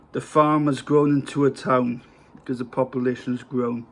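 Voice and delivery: ordinary talk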